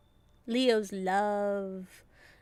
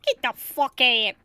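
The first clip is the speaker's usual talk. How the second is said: louder blunt get out; annoyed yell; 9 dB louder than usual. Real, not acted. Player fed up with the psychic, louder curse. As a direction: snap it at her.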